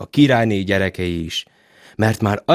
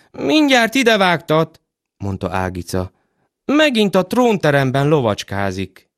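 put-on child voice; 6 semitones higher than usual. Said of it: "Ágica, child speaking".

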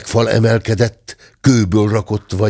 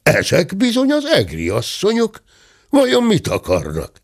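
higher, puzzled musing; mildly astonished gentleman; wondering; 9 semitones higher than usual. A narrator's reading. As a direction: wonder aloud with lifted pitch. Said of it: "dörmögő úr csodálkozva mondotta".

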